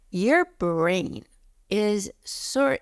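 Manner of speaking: ordinary delivery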